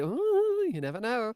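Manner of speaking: high, teasing ooh; teasing mystery. Pretend. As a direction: tease the audience playfully. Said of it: "And it's like".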